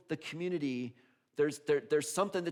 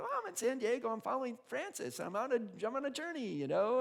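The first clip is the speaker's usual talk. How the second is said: higher, breezy and carefree; naive young self; 11 semitones higher than usual. Retold or imitated. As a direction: say it like it's no big deal. Naive son answers parents.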